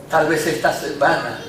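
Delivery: ordinary talk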